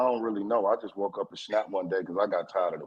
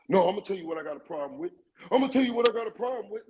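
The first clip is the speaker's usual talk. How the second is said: retold hollering; 9 semitones higher, faster than usual.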